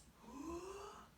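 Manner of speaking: sharp audible inhale; big showy gasp of breath in; demo breath in. Shown as a demonstration. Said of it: "it looks like this".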